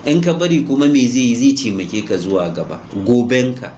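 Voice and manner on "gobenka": ordinary delivery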